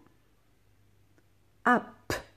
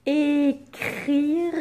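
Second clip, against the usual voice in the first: Écrire said high and loud; loud, stretched dictation of Écrire; emphatic dictation; 6 semitones higher, 5 dB louder than usual. Shown as a demonstration. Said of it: "Mot de la dictée".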